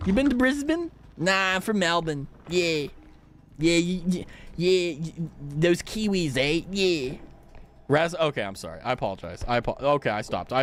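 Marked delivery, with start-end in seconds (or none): 0.1-0.9 high Aussie voice, BRISBANE squashed to "Brizbin"; Australian "Brisbane" with a short, swallowed second syllable; Australian accent. Acted. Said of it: "if I read it in an Australian accent". 1.2-7.2 hammed-up Aussie small talk; Australian accent. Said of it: "if I read it in an Australian accent".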